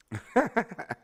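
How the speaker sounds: high, loud cackling laugh; quick burst of high laughter. Real, not acted. Trader cracking up after swearing, high loud laugh.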